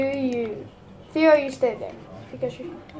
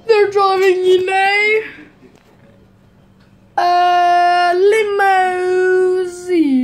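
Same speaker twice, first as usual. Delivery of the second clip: loud, raised showman call; loud hype announcement; 5 semitones higher, 7 dB louder than usual. Pretend. To viewers, announcing the family's limo arrival, loud showman call. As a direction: trumpet the limousine like a hype man.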